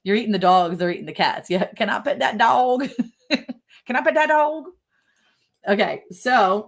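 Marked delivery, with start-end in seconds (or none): 1.7-4.7 playful catchphrase imitation. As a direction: pitch it up and stretch the last word, joking.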